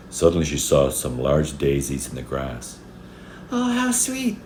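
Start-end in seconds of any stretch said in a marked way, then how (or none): 3.5-4.4 put-on very high, delighted child's coo; high-pitched little-girl admiration; little girl exclaiming. A narrator's reading. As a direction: exclaim in a tiny high girl's voice. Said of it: "she exclaimed".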